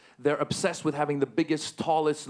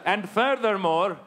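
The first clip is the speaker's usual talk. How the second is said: mock Taliban spokesman; 5 semitones higher, slower than usual. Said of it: "we just want to say".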